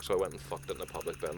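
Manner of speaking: usual speaking voice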